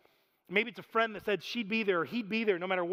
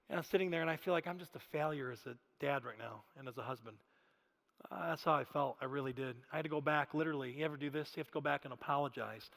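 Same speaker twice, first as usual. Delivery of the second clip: low, soft, remorseful admission; subdued confession; 5 semitones lower, 8 dB quieter than usual. Real, not acted. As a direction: own up quietly to having failed. Confessing his failure after the outburst.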